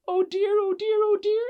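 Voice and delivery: very high, loud panicked cries; screaming and shouting. Acted. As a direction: shriek it like a crowd of panicking elves. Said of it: "everybody started screaming and shouting".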